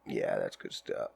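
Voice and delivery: low, soft strained voice holding in smoke; croaky after a deep toke. Real, not acted. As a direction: squeeze the words out with lungs full.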